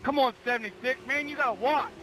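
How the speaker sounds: frustrated shout at another driver; angry yelling. Real, not acted. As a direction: yell it high in frustration. Racer yells at car 76.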